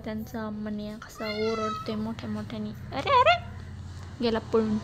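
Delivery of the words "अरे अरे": sudden high call after a running dog; two quick startled calls. Real, not acted. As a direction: shout after the dog as it bolts. Calling after the fleeing dog.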